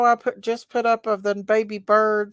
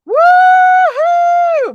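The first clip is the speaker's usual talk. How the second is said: high loud woo-hoo; cheering whoop; 13 dB louder than usual. Pretend. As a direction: let out a big celebratory whoop. Cheering after birthday song.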